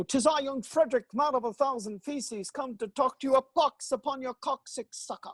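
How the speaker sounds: high, theatrical declaiming voice; hammed-up Shakespearean actor. Comic doing Medical Shakespeare with Robin Williams, hammy declaiming. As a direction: ham it up like a Bard player on stage. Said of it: "And I'd go".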